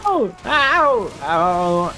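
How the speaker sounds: loud yelps of pain; pained shout. Real, not acted. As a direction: cry out ow, high and loud. Sudden pain in game.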